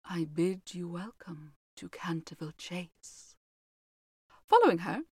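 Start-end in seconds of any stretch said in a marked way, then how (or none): 0.0-3.4 put-on slow, hushed whisper of an old servant; quaint old-fashioned. A narrator's reading. Old housekeeper greeting the family in a slow whisper. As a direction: breathe the welcome softly, old-world and formal. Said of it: "in a quaint, old-fashioned manner".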